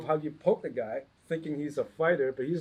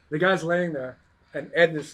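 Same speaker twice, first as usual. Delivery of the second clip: plain reading voice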